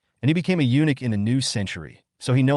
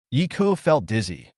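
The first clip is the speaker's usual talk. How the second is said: ordinary delivery, nothing marked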